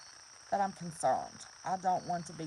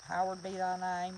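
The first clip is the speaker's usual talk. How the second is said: ordinary talk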